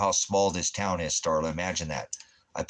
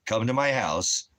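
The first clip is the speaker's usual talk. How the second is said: normal talking voice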